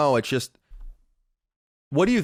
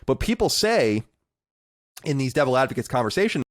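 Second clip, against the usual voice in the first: ordinary talk, nothing marked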